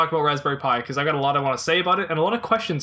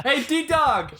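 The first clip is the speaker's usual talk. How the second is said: high, laughing chummy greeting; chummy buddy voice; 10 semitones higher than usual. Pretend. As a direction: hail your old pal.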